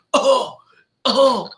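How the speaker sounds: high mock cries of pain; hammed-up groans, one per jab; imitated pain groans. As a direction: yelp as if jabbed in the neck. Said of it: "he just keeps jabbing him".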